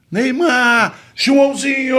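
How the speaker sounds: high, loud shout of both names; excited calling out. Retold or imitated. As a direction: call both kids with equal gusto. Coach calling both kids loudly.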